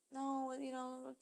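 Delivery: quoted guy, very soft and slow; hushed quote. Retold or imitated. Retelling the guy through the wall, barely audible. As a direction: murmur it like heard through a wall. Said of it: "I hear him saying".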